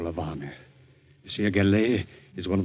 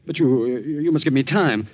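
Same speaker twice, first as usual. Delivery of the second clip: rising higher and louder, pleading; frightened man pleading for time; desperate plea; 6 semitones higher, 4 dB louder than usual. Acted. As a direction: beg them for time. Begging the plotters for time.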